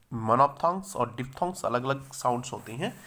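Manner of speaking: ordinary delivery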